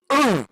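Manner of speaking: short high grunt of disgust; disgusted ugh. Retold or imitated. Man reacting to a foul toilet, disgusted grunt. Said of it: "and he goes".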